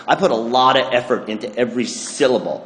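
ordinary talk, nothing marked

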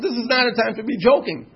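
laughing scold. Retold or imitated. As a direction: scold her but keep laughing. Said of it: "I said".

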